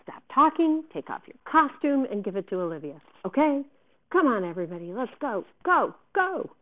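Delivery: loud, high barked orders; angrily. A narrator's reading. Director clearing Miranda and cast away, angrily. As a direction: chivvy everyone along in a temper. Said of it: "Davenport said to me angrily".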